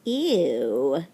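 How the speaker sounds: loud drawn-out "ew", falling low; playful disgust. Pretend. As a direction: recoil at an ugly bug. Reacting to ugly stink bug picture.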